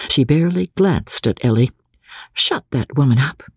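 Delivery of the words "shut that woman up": ordinary delivery, nothing marked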